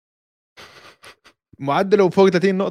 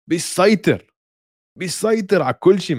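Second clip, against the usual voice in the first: excited emphasis; 5 semitones higher, slower than usual. Real, not acted. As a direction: hype it up with energy. Hyping player, emphatic repeat.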